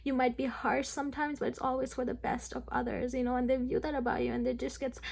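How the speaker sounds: ordinary delivery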